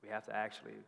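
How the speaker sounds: dad softly telling his daughter they can't buy the dolls; gentle letdown. Acted. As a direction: let her down gently. Dad breaks daughter's heart.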